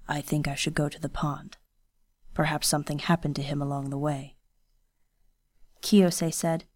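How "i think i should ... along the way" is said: lightly voiced stern young ronin, hushed; a hint of a guarded swordsman speaking low; quiet, restrained ronin voice; Kenishi, low and quiet.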